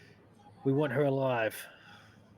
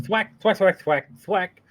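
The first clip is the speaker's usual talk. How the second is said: thwack sound effects; 4 semitones higher, 7 dB louder than usual. Pretend. Mimicking repeated blows, laughing.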